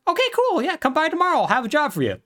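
high, breezy cheerful mob boss; lightly voiced Eddie, all sunny and casual; chirpy boss voice. Podcaster voicing Eddie dismissing Vito, high and chirpy.

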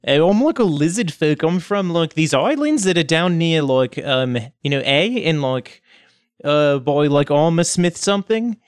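put-on casual, rambling character accent; lizardfolk Reese. Reese explains his homeland to kobolds, casual rambling voice. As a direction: fumble amiably through where you are from.

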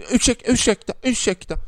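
put-on high squeaky old lady pleading ursäkta; mock polite old lady. Old lady squeezing into the lift, high mock voice.

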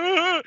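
short high panicked yelp; mock panic scream. Jay and Bob panic and hide, hammed-up yelp. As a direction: yelp like a cartoon coward. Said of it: "panic where they run back and forth".

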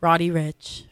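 hushed nature documentary narrator. Acted. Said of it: "with my narrator voice".

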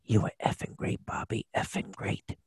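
imitated whisper in the ear; Joe Pesci. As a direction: whisper it confidentially, no voice at all. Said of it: "he whispers in my ear".

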